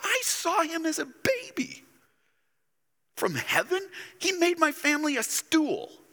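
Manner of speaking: mocking neighbour. Preacher voicing townsperson mocking Jesus, high and sneering. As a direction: scoff at the carpenter's son.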